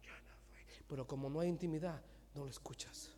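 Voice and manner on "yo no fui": tiny whispered aside; God's quiet denial. Acted. God disowning the believer's open door, whispered. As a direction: whisper it under the breath. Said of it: "Y Dios dice".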